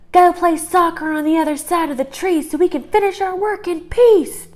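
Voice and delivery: annoyed rabbits shouting. Acted. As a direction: shoo him off, fed up. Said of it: "The rabbits shouted at Ralph".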